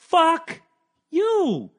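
high, loud scornful retort; scornful outburst. Acted. Snapping back at the coddling question, loud scorn. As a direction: push it high and loud, word by word.

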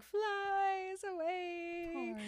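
high, long stretched-out words; flung away. Retold or imitated. Donkey catapulted off the tree, drawn-out high wail. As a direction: draw it out like someone sailing off.

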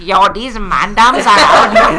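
slow Toronto roadman slang, laughing; Toronto man accent. Retold or imitated. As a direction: ham up a Toronto mans voice. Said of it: "his Toronto man accent".

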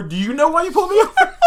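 high, loud counter-question breaking into laughter; hammed-up squawk back at an officer; mock indignant comeback. Driver pulled over by a cop, mock indignant retort.

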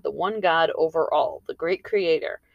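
usual speaking voice

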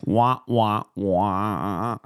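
muffled wah wah talk. Pretend. Mocking sad trombone sound.

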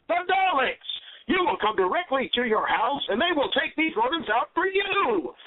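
hyped infomercial pitch; ad read. Acted. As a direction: sell it like a cheesy commercial. Sponsor ad read mid-podcast.

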